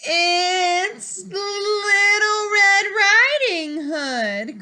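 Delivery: put-on high, loud, slow sing-song girl voice; the wolf faking a sweet little girl. Wolf tricking Grandmother at the door, high sing-song girl voice. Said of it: "called the wolf in a little girl voice".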